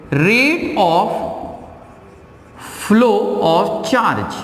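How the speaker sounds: very slow, word by word for notes; dictating a definition at half speed; slow dictation. Shown as a demonstration. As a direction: spell it out slowly so they can write. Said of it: "I'm writing here".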